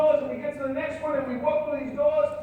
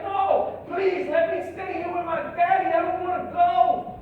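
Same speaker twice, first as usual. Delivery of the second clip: loud, high imitation of a pleading child; raised-voice pleading, higher and louder; retold screaming plea; 5 semitones higher, 5 dB louder than usual. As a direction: act out a little girl begging to stay. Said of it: "she's crying and screaming".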